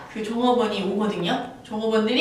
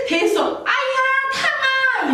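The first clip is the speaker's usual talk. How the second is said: high, long-drawn yelp; acted ouch; 13 semitones higher, much slower than usual. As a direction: stretch out the ouch like a burn. Acted pain. (혀를 데어 높게 길게 끄는 "아야")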